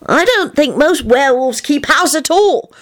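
put-on high little-kid know-it-all voice; high, matter-of-fact child. A narrator's reading. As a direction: inform them like a small expert. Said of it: "Teddy said knowingly".

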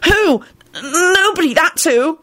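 high, stammering rhetorical challenge; demanding. A narrator's reading. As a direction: demand it, then answer yourself. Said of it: "Melvin demanded".

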